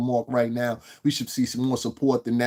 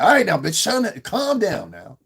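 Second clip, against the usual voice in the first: put-on firm boyfriend voice; 6 semitones higher, 4 dB louder than usual. Man to his drunk girlfriend, loud firm order to calm down. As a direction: boss her into calming down.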